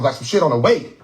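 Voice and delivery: ordinary talk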